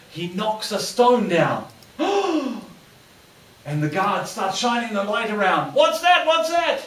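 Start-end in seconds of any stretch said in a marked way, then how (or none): 2.0-2.6 a sudden high gasp of alarm; sharp gasp. Retold or imitated. Brit knocks a stone off the fence, sharp gasp. 5.7-6.8 higher, louder suspicious call; alert guard call. Retold or imitated. Prison guard hears a noise and calls out sharply.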